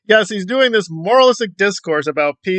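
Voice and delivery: usual speaking voice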